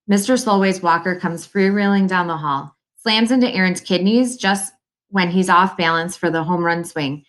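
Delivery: plain reading voice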